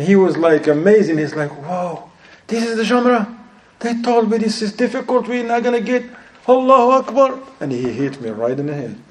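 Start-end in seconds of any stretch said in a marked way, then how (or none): 1.6-7.4 high, amazed voice of an excited pilgrim; excited Egyptian pilger. Retold or imitated. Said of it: "He's like, he talked to me in Arabic".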